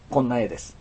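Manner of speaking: ordinary delivery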